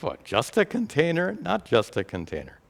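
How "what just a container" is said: higher-pitched, mock-surprised query; incredulous listener's rising question; doubting question. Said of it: "you might think".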